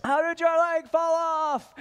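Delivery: put-on high, slow child voice calling out; curious kid. Child asks the amputee, high and slow. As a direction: ask like a blunt little kid. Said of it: "kids will come up to me and be like".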